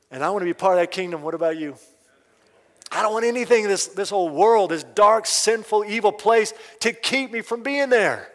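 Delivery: fast, loud, rising pulpit cry; impassioned preaching. Acted. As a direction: drive the appeal home to the congregation. Preacher building to climax.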